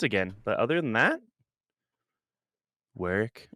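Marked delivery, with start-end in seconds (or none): 3.0-3.5 a hint of a robotic GPS, pitched lower; low, quiet GPS-style delivery. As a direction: say it lightly like a navigation app voice. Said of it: "you reminded me of the voice".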